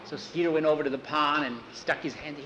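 usual speaking voice

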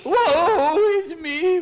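high, slow, loud mock lament; overacted woe-is-me crying; hammed-up wailing. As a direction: wail the woe again on request. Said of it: "Would you like me to do it again?".

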